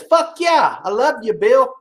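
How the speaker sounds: cheering read. Retold or imitated.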